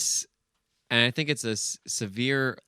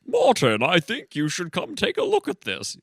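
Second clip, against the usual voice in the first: officer character voice; 9 semitones higher than usual. Acted. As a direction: play the other cop in a high silly voice. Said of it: "I'm using a lot of my voices today".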